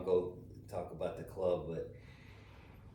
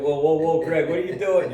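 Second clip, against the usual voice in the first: loud, high, laughing mock alarm; re-enacted startled shout; retold alarmed call; 9 semitones higher, 12 dB louder than usual. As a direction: call out a warning, amused. Retold warning as cart nears tree.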